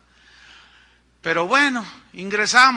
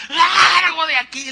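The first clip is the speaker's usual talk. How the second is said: furious yell, much higher and louder, slow; angry shout; 10 semitones higher, 11 dB louder, slower than usual. Retold or imitated. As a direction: bark the order to get out. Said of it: "Y hasta enojado le dice". (grito furioso, lento y mucho más agudo)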